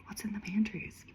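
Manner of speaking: whisper. Real, not acted. Teasing the mystery to viewers.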